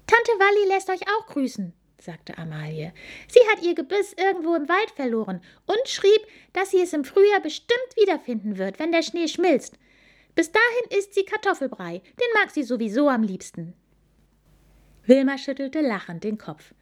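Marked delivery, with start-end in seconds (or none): 0.1-1.7 put-on high, quick childlike voice; young wild boar girl. Amalie figure voice. 3.3-13.7 put-on high, quick childlike voice; young wild boar girl. Amalie figure voice.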